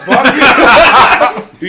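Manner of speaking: high, very loud comeback with laughter; laughing shout. Real, not acted. Told by co-host to shut up, laughing shout. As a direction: yell it back, laughing hard. Snapping back at co-host's shut up.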